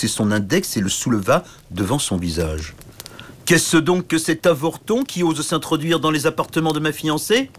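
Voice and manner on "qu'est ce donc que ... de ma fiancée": bully the princess with questions; oafish knight demanding. Acted. Grand chevalier méprise le petit. (grand chevalier stupide qui interroge)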